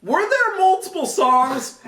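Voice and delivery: high, lightly mocking question voice; imitating producer's question. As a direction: quote her in a high voice. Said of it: "She asked me".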